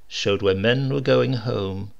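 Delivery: ordinary delivery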